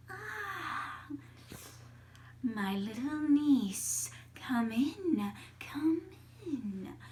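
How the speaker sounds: put-on slow, drawn-out old witch voice; creepy old aunt welcoming her prey; Baba Yaga. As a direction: relish every word, stretching it out. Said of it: "out of the door stepped".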